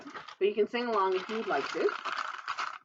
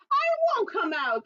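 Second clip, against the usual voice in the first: high, louder refusing little groundhog; stubborn groundhog; 13 semitones higher, 4 dB louder than usual. Acted. Groundhog refusing the townspeople, high and stubborn. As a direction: dig in your heels, sulky and high. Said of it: "the groundhog said".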